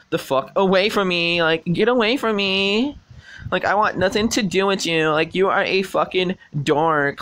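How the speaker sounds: mocking the girl who wants nothing to do with him; mock dismissive girl. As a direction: play the stuck-up girl brushing him off. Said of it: "I gotta fucking roleplay this shit".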